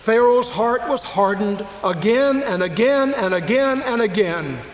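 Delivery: high, loud, slow preacher build; building preaching. Acted. Preacher stressing Pharaoh's hardened heart, high and loud. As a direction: hammer each again harder.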